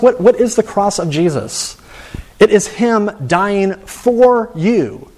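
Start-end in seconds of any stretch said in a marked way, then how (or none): 2.4-5.1 "for" drawn out and set apart, slow phrase; stress on "for". Real, not acted. Preacher explaining the cross to listeners, stress on "for". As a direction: lean slowly and deliberately on the word "for". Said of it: "That's why I emphasize the word for".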